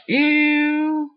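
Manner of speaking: very high, loud drawn-out squeal; mock disgust. Friend reacts to getting hyper off speaker, mock-disgusted squeal.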